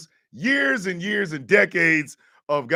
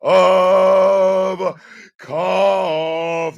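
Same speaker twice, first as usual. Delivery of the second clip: ringmaster; 8 semitones higher, 11 dB louder, much slower than usual. Acted. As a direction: show title drawn out big and slow. Show title announced.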